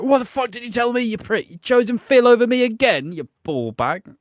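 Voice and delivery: high, loud, indignant rant; hammed-up fury, falling away on the final insult; acted outrage. Grant berating his dying friend Nigel, acted outrage. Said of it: "and you're just like".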